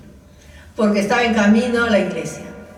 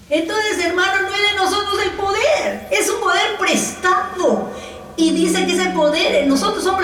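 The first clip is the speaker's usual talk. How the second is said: moved, half laughing; 7 semitones higher than usual. Real, not acted. Emotional peak of the sermon.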